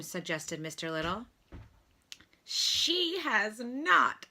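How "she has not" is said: high, slow, tearful denial; bitter sobbing protest. A narrator's reading. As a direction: protest through tears, drawn out. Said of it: "sobbed Stuart bitterly".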